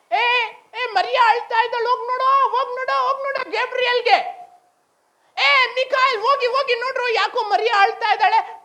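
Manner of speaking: high-pitched urgent call to send helpers; urgent command. Acted. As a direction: call out insistently, high and pressing. God urgently sending angels to Mary.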